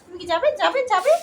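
high sing-song coaxing to a pet; high coaxing call. Real, not acted. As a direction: coax the pet, pitch up high. Calling the pet to come. (উঁচু গলায় আদুরে ডাক)

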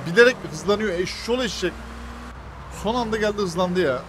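loud furious yelling at the car; angry outburst. Real, not acted. Gamer yelling at a driver who rams him, angry. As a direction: yell in real frustration at the speeding driver.

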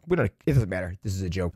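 normal talking voice

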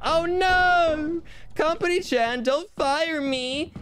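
squeaky high plea, slow at the end; high pleading voice. Pretend. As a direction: beg like a panicked cartoon. Begging the boss, high put-on voice.

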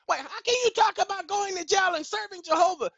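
high-pitched imagined heckler cutting in; mock objection in a high voice; challenging objector. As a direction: break in as an incredulous objector. Voices an objector interrupting him.

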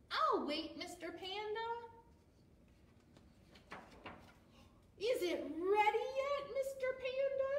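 eager high little penguin. Acted. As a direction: voice the patient penguin up high and bright. Patient penguin speaks.

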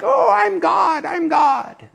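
mock bragging shout; mock boasting. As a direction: ham up a puffed-up little man crying I'm God. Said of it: "Puny little man down here saying like".

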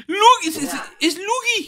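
very high, stammering excitement; overjoyed outcry. Pretend. Player spotting Luigi in the game, overjoyed. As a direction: cry out as if spotting a lost brother.

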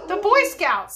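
put-on high, loud boy's voice; acted boy calling out brightly from a crowd; boy suggesting. A narrator's reading. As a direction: pipe up like a boy with an idea. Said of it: "suggested a boy".

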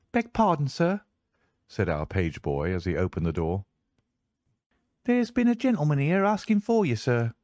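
put-on high, eager young servant voice; a boy servant speaking up to his master; page boy. Page boy reporting a visitor to Holmes, high and eager.

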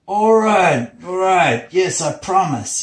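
high, loud giving-in cries; acted frantic yielding, pitched high; desperate surrender. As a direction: cry out as if beaten into agreeing. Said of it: "could not escape the determined blows".

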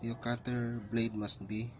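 ordinary talk

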